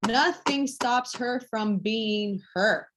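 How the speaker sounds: "Nothing stops her from being her" stretched out slowly; slow emphatic repeat. Real, not acted. Coach repeating "Nothing stops her from being her" to girls, slow and emphatic. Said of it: "I'm gonna say it again".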